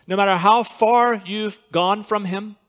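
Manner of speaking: usual speaking voice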